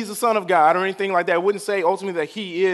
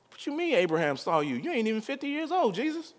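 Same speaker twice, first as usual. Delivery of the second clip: hushed disbelief climbing high in pitch; quiet, high mock bafflement; incredulous; 4 semitones higher, 7 dB quieter than usual. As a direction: softly question an absurd claim, voice rising to a squeak of doubt.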